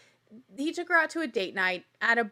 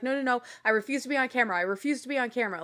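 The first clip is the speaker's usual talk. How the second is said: fast refusal; much faster than usual. Retold or imitated. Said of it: "he's running 'cause he's like".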